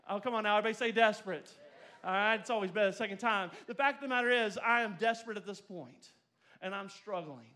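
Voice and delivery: loud, driving sermon storytelling; full-force preaching. Acted. Preacher urging the church on, loud and driving.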